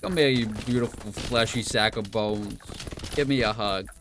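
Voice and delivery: hammed-up Jersey or New York accent. Jokingly greeting a relative as a bag of bones, Jersey accent. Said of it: "from Jersey? ... Or New York or something?".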